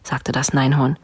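ordinary delivery, nothing marked

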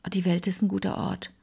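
leise hingehaucht, ganz ohne Stimme; flüstere es wie ein stilles Staunen; geflüsterter Gedanke. Retold or imitated. (gerührt über die Hilfe der jungen Frau, geflüstert)

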